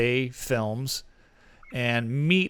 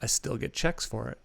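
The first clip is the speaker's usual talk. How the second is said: normal talking voice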